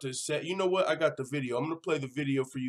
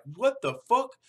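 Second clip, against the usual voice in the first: incredulous; 8 semitones higher, 3 dB louder, slower than usual. Retold or imitated. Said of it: "Jimmy Rivera was like".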